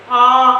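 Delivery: आ as a loud, long open aa; vowel sound demo. Shown as a demonstration. Teacher shows double A's sound, loud held vowel. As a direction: hold आ out loudly on its own. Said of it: "तो उस वक़्त आप कहेंगे".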